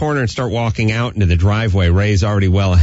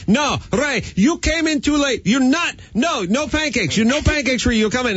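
very high, mock-screaming German mother; shrieky pitch without real volume, German-accented; screaming German mom. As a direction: imitate a little woman scolding her son. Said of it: "little woman who would scream at Ray".

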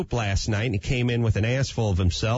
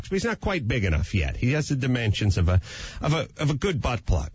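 ordinary talk

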